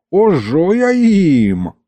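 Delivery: high, drawn-out battle cry; comic old-man war cry; feisty threat. A narrator's reading. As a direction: threaten them like a fired-up grandfather. Grandfather leaps up with hatchet.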